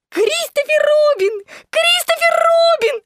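hammed-up, very high wailing piglet; sobbing. A narrator's reading. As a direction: wail for rescue in a tearful squeal. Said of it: "рыдал Пятачок". (плаксивый писклявый вопль)

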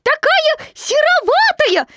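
very high, loud squeaky piglet; a timid piglet's excited squeal; tiny piglet squeaking. A narrator's reading. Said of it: "сказал Пятачок".

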